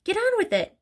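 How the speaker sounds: high-pitched, impatient voiced hiss; urgent hiss. A narrator's reading. Mother goads her unmoving husband, hissing impatiently. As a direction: hurry him along in a high voice. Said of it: "hissed the mother".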